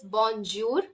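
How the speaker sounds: "bonjour" with a hard dj for the J; wrong English-J "bonjour"; anglicized bonjour. Shown as a demonstration. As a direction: mispronounce "bonjour" slowly, n sounded and J as in jam. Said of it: "Please do not call it".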